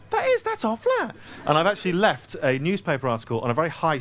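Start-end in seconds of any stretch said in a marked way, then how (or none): 0.1-1.1 sudden much higher exclamation; suddenly recognize your flat; surprised realization. Pretend. Imagined tenant realizing.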